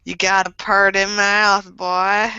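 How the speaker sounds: slow Kansas drawl, creepy backwoods line; Kansas creeper accent. Acted. Friend redoes the line on request, in a Kansas accent. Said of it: "you gotta say it in the accent".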